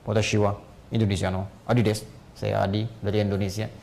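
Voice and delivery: low-pitched imitation of a Japanese self-introduction; Japanese accent impression. Shown as a demonstration. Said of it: "Bicara apapun mirip".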